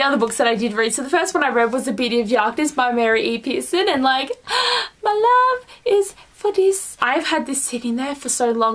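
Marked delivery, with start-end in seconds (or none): 4.3-5.0 sharp high gasp of delight; sudden thrilled intake of breath. Real, not acted. Gasps at beloved book. 5.0-7.0 high, slow and swooning; drawn-out lovestruck gush. Real, not acted. Gushing over favourite book.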